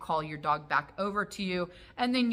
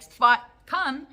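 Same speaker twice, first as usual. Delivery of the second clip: higher, louder call to a dog; recall command; 6 semitones higher, 8 dB louder than usual. Shown as a demonstration. Owner calls dog away from pizza, loud recall.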